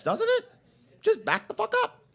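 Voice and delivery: squeaky, laughing self-mockery; high-pitched incredulity. Comic mocks himself for not backing up, high-pitched. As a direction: shoot way up high in comic disbelief. Mocks own failure to back up.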